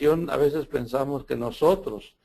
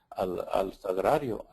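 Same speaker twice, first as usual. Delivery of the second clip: usual speaking voice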